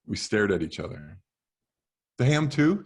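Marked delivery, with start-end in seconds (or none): 2.2-2.8 mild cashier voice. Acted. Cashier asks about ham.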